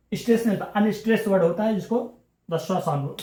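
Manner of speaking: ordinary talk, nothing marked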